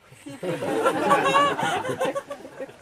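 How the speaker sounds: a high burst of laughter; high amused laugh. Real, not acted. Teacher amused by the friend's new trouble, laughing. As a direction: laugh at the irony.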